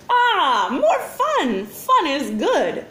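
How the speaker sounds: high, slow, happy alien burble; burbling alien commander. A narrator's reading. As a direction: burble with childish delight. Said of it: "burbled the commander happily".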